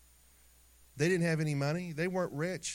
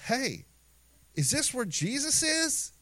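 curious shepherds; 11 semitones higher, slower than usual. Retold or imitated. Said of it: "and they go".

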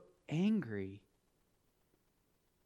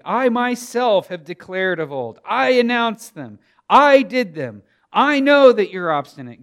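every "I" hit loud and high; booming, insistent scripture quote; emphatic declaration; 4 semitones higher, 14 dB louder than usual. Acted. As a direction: proclaim as God, hammering each "I". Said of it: "He says it emphatically".

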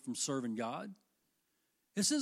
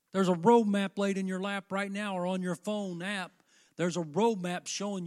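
full-force preaching, louder, pounding the points home; 2 semitones higher, 6 dB louder than usual. Acted. Pastor urging the church to follow God's way, full-force preaching. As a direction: drive it at the congregation like a revival preacher.

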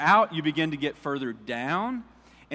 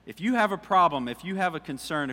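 ordinary talk, nothing marked

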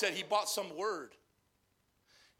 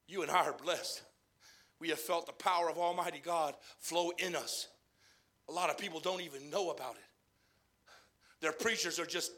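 softer, lower confiding sermon; intimate preaching; 5 semitones lower, 5 dB quieter than usual. Acted. Preacher confiding to his own church, soft and sincere. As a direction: draw them in close.

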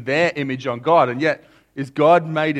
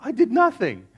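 put-on child denial; 9 semitones higher than usual. Son denying to dad.

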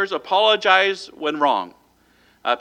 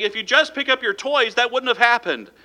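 mock yell; 7 semitones higher than usual. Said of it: "Yelling at the child".

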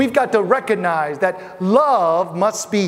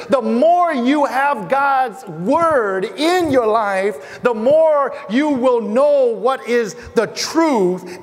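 slow preaching emphasis; 3 semitones higher, much slower than usual. Real, not acted. Preacher drives home point.

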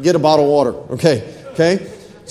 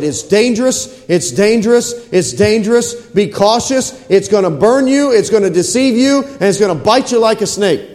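raised, insistent pulpit warning; higher voice, driving the alarm home; urgent preached warning; 4 semitones higher than usual. Retold or imitated. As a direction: hammer each warning home in rhythmic repetition. Preacher voices God's repeated warning.

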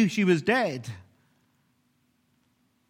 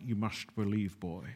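very low, far deeper Scottish-tinged growl; Sean Connery impression; 9 semitones lower, 10 dB quieter than usual. An explorer's father calling across the ravine, low Connery voice. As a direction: drop right down into Connery's gravel. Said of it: "The father's played by Sean Connery, so it's more like".

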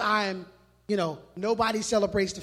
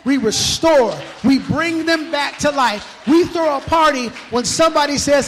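rising shout; 3 semitones higher, 7 dB louder than usual. Real, not acted. Preacher building to climax.

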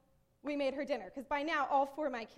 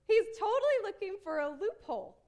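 high, loud emphatic call-out; gleeful exposing; 7 semitones higher, 7 dB louder than usual. Real, not acted. Preacher exposing the lawyer's trick, emphatic. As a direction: pounce on it, pitched up and loud.

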